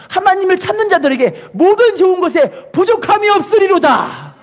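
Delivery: high-pitched, ringing scripture declaration; emphatic proclamation. Acted. Preacher declares the psalm's promise to congregation, high emphatic proclamation. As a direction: declare the verse high, pushing each phrase.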